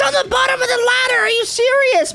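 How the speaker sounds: exasperated yell at dad; indignant shout. Real, not acted. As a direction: shout it in frustrated disbelief. Dad says he can't find key.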